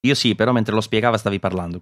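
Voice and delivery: ordinary talk, nothing marked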